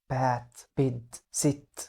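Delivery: "بيد" said slowly with a short e; short vowels. Shown as a demonstration. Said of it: "إحنا نطقناهم إزاي".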